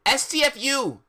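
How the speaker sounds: high, loud mock outburst; hammed-up high-pitched shout. A narrator's reading. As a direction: yell the letters out. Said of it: "Shooted Cornelia fuck".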